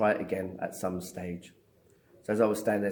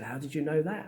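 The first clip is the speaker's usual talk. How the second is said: retold disbelief, higher than usual; incredulous question; 7 semitones higher than usual. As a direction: imitate an astonished doctor, pitch raised. Consultant baffled by what she knows.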